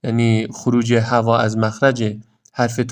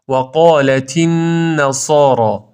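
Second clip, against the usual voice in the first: very slow, chanted recitation; Quran recitation; 3 semitones higher, 4 dB louder, slower than usual. Shown as a demonstration. Teacher reciting the phrase slowly for students.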